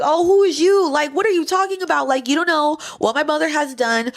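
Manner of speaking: sister talking back. Retold or imitated. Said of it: "My sister was like".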